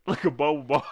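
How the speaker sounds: high, loud words bursting into laughter; real laughter taking over; laughing hard. Real, not acted. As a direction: crack up on the comparison. Still laughing at the sight.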